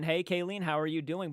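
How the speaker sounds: ordinary delivery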